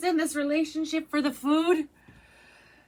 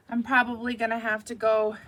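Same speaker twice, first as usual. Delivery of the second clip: normal talking voice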